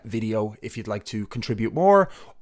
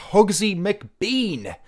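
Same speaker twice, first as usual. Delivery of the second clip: high, very slowly savoured name; playful drawn-out name; 7 semitones higher, much slower than usual. Acted. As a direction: relish the silly name. Reading a follower's funny name.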